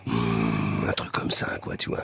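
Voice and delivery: evil laugh sinking to a very low hush; chuckle, then murmur low like a villain; soft, deep bad-guy voice. Shown as a demonstration. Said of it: "T'as une voix de méchant".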